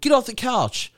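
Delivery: high-pitched mock order to a dog; calling a command. Dog owner ordering his big dogs around, retold high-pitched command.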